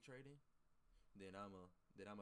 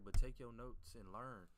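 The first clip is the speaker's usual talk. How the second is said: much louder and slow, leaning on each word; emphatic advice; 12 dB louder, slower than usual. Real, not acted. Streamer telling viewers to learn instead of copying, emphatic and slow. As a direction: drive home the lesson.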